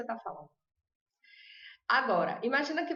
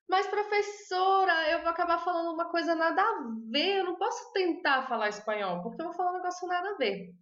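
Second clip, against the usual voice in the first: higher, pleading student protest; imitating a worried student; 6 semitones higher than usual. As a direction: whine the doubt like a nervous learner. Student voiced objecting to teacher.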